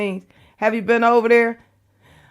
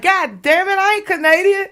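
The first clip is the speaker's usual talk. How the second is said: mock exasperation; 9 semitones higher than usual. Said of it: "I be like".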